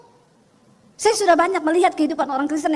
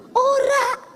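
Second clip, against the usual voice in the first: stubborn denial; 7 semitones higher, 3 dB louder than usual. Retold or imitated. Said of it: "Dia tetap ngeyel".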